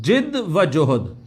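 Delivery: जिद व जोहद spaced out slowly, each word separate; slow careful pronunciation. Shown as a demonstration. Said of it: "पढ़ा ये जाता है जिद व जोहद".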